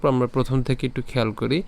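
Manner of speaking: ordinary delivery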